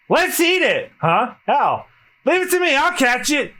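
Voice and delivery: put-on high, excited boyish shout; high-pitched eager yell. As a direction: go for it like a hungry kid. Luffy eager to catch bird.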